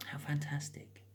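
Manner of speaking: soft whispered comment; hushed little aside. Real, not acted. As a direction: whisper it to yourself. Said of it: "whispered remark".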